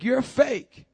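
acted blunt condemnation; harsh accusation. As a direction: throw the accusation out rashly. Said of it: "say it as rash and as harsh as that".

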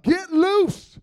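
loud, high exhortation, second time bigger; hyped-up preacher's yell; playful shout. Real, not acted. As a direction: holler it to get the crowd moving. Urging the congregation to loosen up.

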